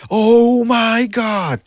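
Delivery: long drawn-out, high loud holler; mock commentator scream. Streamer mimicking commentators at a stoppage, screaming. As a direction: stretch it out like a hysterical ringside announcer. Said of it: "they were just screaming their lungs off".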